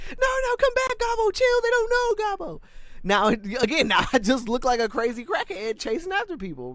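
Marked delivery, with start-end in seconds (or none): 0.1-2.6 very high mock pleading; pleading call. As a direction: play a companion calling someone back in falsetto. Imagined companion calling the goblin back.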